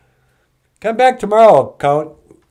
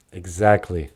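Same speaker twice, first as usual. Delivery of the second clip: normal talking voice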